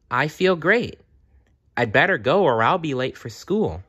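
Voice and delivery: high, bright insistence; lightly voiced girl faking wellness; falsely cheerful. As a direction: pretend you're fine and hurry off. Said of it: "Jenny hides her sickness".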